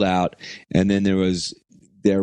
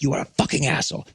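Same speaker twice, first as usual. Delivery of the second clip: fast put-on accented insult, higher pitch; imitated yelling Belgian; 4 semitones higher, much faster than usual. Angry local man to the touring musician, imitated insult. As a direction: spit it out quickly in his voice. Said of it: "he was just like".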